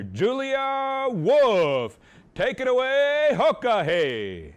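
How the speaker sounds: loud, high arena announcer call; rally the crowd like an emcee; powwow emcee. Real, not acted.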